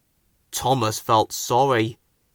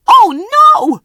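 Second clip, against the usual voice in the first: much higher, louder alarm; startled gasp of words; 12 dB louder than usual. A narrator's reading. Thomas hearing Toby's bell, loud alarm. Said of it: "thought Thomas".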